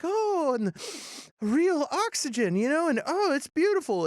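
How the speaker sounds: lightly voiced child, breathing in the new world; awed little girl. Girl marvels at the planet.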